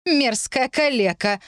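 медленно и высоко, с презрением; выплюнь оскорбление, растягивая слова; злобно выплюнуть. A narrator's reading. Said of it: "выплюнула она". (презрительно, сквозь злость, врастяжку)

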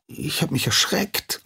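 high, tearful little voice; startled apprentice near crying; frightened whimper. Acted. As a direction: squeak it out, close to tears. Frightened apprentice after scolding.

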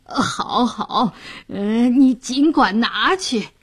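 put-on drunken, indulgent slur with little hiccupy fillers; tipsy official. A narrator's reading. Drunk official giving in to the coaxing fishwife, tipsy and indulgent. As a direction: agree grandly through a boozy haze. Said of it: "杨衙内又一盅酒下肚".

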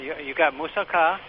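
ordinary talk, nothing marked

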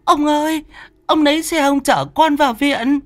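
high-pitched frantic wail; panicked mother. Acted. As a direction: cry out for urgent help for your son. Mother voiced, son has collapsed.

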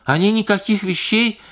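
high, squealing protest; shrill squeal. A narrator's reading. Singer squealing her denial to the wife. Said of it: "взвизгнула Паша".

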